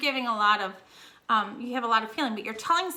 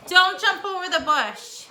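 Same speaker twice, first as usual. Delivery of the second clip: louder, slower, higher warning; firm warning; 6 semitones higher, 7 dB louder than usual. Real, not acted. Teacher warning James away from the bush, loud and firm. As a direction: call the warning across the yard.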